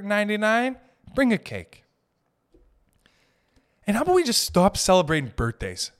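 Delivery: mock exasperated rant. Real, not acted. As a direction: lecture them like a fed-up host. Ranting at birthday freeloaders.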